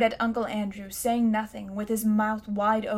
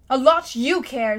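louder, slow and fierce; 3 semitones higher, 8 dB louder than usual. Acted. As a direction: spit it at the uncle. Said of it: "said Diggory fiercely".

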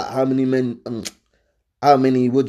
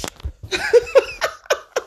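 high laugh. Real, not acted. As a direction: burst of high-pitched laughter. Laughs at own joke.

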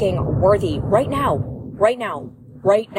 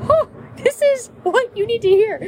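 much higher, bubbly inner thought; excited inner voice; 12 semitones higher than usual. Retold or imitated. Host voicing her own thought to listeners, excited. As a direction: chirp it like a sudden idea. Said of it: "I genuinely just feel like".

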